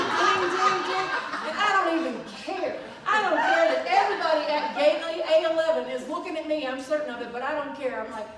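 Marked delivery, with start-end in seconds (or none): 0.0-1.1 loud, high imitated bowl chimes; high mock chiming; singing bowl dings. Imitates bowl being struck. 1.5-6.7 loud, high, hammed-up wail; comically overwrought cry; heedless outburst. Retells heedless outburst, crowd laughing.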